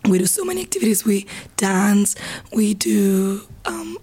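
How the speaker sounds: hoarse whisper, voice giving out; losing her voice. Real, not acted. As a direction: croak through a sore, fading voice. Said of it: "give her voice a little rest".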